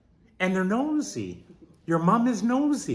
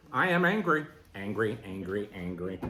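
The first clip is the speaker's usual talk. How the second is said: much lower and softer on each angry; fading repetition; 12 semitones lower, 9 dB quieter than usual. Shown as a demonstration. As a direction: let each angry die away. Repeating angry, trailing off.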